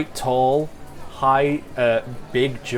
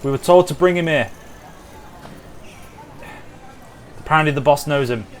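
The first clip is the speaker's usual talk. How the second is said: raised, urgent soldier's call from the back; soldier shouting out; 4 semitones higher than usual. Acted. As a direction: call it out to the boss's men. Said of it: "kinda shouts out".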